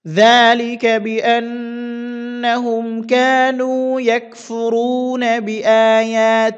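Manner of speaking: high, loud, very slow melodic tilawah; Quranic recitation. Shown as a demonstration. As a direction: chant the verse in long drawn-out melodic phrases. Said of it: "একসাথে আটি বলে দিচ্ছি".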